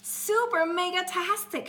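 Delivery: high, loud thrilled shout; excited shout. Acted. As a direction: shout it with glee. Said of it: "shouted Angelina".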